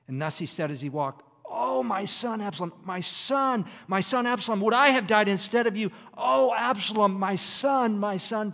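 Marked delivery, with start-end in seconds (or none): 1.5-8.5 loud, high-pitched anguished lament; wailing king in mourning, at full voice; grieving father's cry. Acted. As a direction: cry out for a lost son, stretching the Oh. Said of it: "he cries out".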